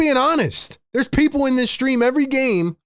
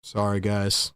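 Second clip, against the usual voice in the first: much lower, softer sheepish apology; deflated, say sorry after blowing up; frustrated apology; 12 semitones lower, 6 dB quieter than usual. Real, not acted. Streamer apologizing to chat after his crash out, soft and deflated. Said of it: "I'm just frustrated".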